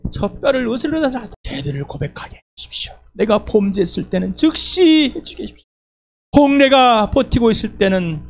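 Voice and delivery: high, pleading prayer voice; earnest prayer. Retold or imitated. Preacher voicing his own confession to God, pleading prayer. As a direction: pray it out, begging for confession.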